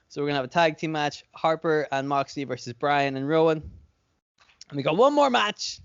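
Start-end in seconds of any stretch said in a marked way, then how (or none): 4.7-5.8 hyped announcer. Shown as a demonstration. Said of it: "Let me go back all the way".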